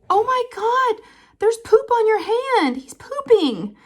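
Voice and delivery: mock horrified kid yelling oh my God; shocked shriek. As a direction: squeal it like a grossed-out little girl. Friend shrieks about poop.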